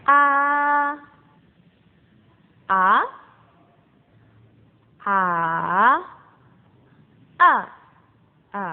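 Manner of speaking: "A" level, then rising, then dipping, then falling; four tones in sequence. Shown as a demonstration. Teacher running through the tones on "A" for pupils to repeat. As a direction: run "A" through the tones, one after another. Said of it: "dari nada pertama sampai nada kelima".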